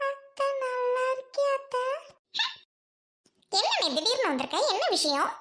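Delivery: haughty sister scolding at her gate; snobbish rich sister. Acted. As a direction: talk down to the noisy crowd at the gate. Sister greets, then presses brother.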